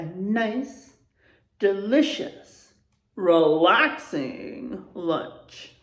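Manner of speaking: slow, savoring, drawn out; storybook ending. A narrator's reading. Teacher closing the story for children, slow and savoring. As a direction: relish the cozy ending.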